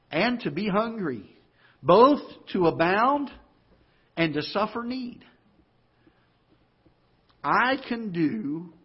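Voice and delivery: measured preacher's reading, phrase by phrase with long pauses; emphatic scripture reading. Acted. Preacher reads a key verse to the congregation, emphatic and deliberate. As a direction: read the verse out slowly and weightily.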